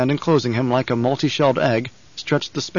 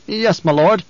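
nervous guard captain; 6 semitones higher, 4 dB louder than usual. Acted.

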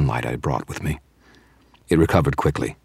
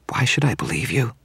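higher whispered vampire question; hissed question; 8 semitones higher than usual. Acted. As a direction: hiss it back in suspicion. Vampire then wizard repeat line.

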